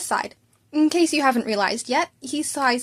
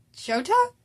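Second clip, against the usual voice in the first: higher, cautious question; hesitant; 8 semitones higher than usual. A narrator's reading. Worried friend asking hesitantly, higher. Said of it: "he asked hesitantly".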